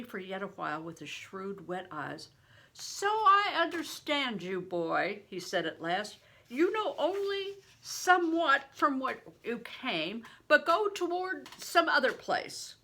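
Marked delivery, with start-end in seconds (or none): 2.7-5.2 higher, louder, slow booming blessing; a giant of a man, booming and slow; big stranger. A narrator's reading. 6.5-12.8 big stranger. A narrator's reading.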